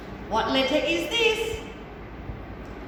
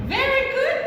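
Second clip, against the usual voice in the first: excited praise; 12 semitones higher, 4 dB louder than usual. Acted. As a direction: gush over the puppet's answer. Praising puppet warmly.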